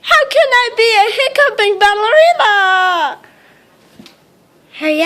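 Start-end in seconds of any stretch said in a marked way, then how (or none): 0.0-3.2 whimpering crying wail. Acted. Worried ballerina with hiccups cries before the play. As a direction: wail it through tears.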